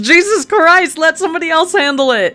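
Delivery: high, loud exasperated yelling. Real, not acted. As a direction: shout at the screen in frustration. Player yelling at the character.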